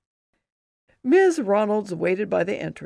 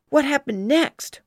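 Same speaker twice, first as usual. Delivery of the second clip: put-on eager kid; 7 semitones higher, 5 dB louder than usual. Kids eager for the story.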